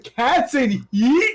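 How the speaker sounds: slow, drawn-out disbelieving question; incredulous echo. Retold or imitated. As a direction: repeat it back slowly, not buying it. Retold disbelief at the explanation.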